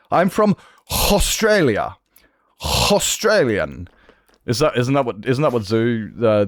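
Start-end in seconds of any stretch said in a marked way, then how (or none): 0.9-3.9 Australia and Australian opened with a long scraped "kh"; playful slow guttural onset on Australia, Australian; guttural throat sound. Shown as a demonstration. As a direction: drag a throaty "hhh" into Australia and Australian, slowly. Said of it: "Do they have the".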